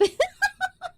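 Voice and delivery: a quick giggle at her own joke; high laugh. Real, not acted. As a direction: burst into a delighted high laugh. Reacting to chat compliment.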